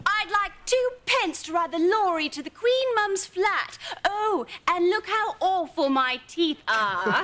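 exaggerated English accent, high and loud; hammed-up English accent, laughing near the end; mock English accent. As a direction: parody a Cockney-posh English accent. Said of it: "it is better than your English accent".

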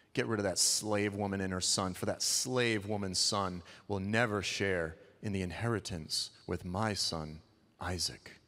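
low, cold demand, dropping to the end. Retold or imitated. Sarah ordering Abraham, low and cold. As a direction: hiss it down low like a jealous wife. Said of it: "Sarah is mad".

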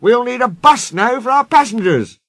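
high, urgent announcement; dismayed driver. Acted. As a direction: call it out with concern. Driver announces breakdown remedy.

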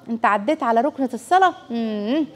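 normal talking voice

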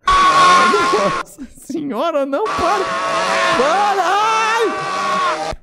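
high, drawn-out wails of shock; high-pitched shocked shouting; horrified outcry. Real, not acted. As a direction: cry out high at the gore, stretching the words. Streamer reacting to gory game scene.

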